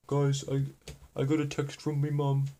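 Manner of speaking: high put-on British accent, rushed goodbye; mock British accent. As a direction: play a flustered Brit leaving the stream. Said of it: "I have an accent now".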